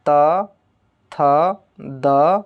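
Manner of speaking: "त", "थ", "द" drawn out, a pause after each; letter pronunciation demo. Shown as a demonstration. Teacher repeating dental letters for students, isolated and drawn out. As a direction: sound out "त, थ, द" slowly as isolated syllables. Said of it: "ल का एक बार उच्चारण करिएगा".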